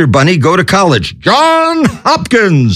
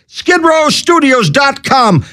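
normal talking voice

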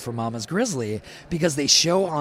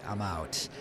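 quiet exit; 6 semitones lower, 10 dB quieter than usual. Retold or imitated. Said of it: "left my jobs like".

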